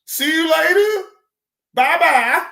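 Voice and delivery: high, loud singsong bye-bye; mocking high farewell. Streamer mimicking YouTube kicking him off, high singsong. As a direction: sing the goodbye like a taunting kiss-off. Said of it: "they most definitely told me".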